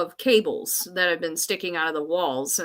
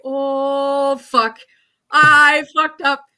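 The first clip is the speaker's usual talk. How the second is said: loud, high-pitched dismay at a mistake; panicked swearing; 5 semitones higher, 8 dB louder, slower than usual. Retold or imitated. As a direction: blurt it out in horror, louder and higher. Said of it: "the realization dawns upon me, and I'm like".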